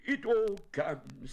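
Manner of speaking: high, loud, slow bear grumble; cross. A narrator's reading. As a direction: play a peeved bear, high-pitched. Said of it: "said Winnie the Pooh crossly".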